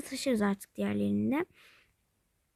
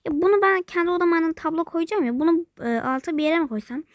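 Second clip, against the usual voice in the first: ordinary talk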